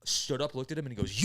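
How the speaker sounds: ordinary delivery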